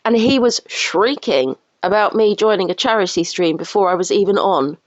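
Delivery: ordinary talk, nothing marked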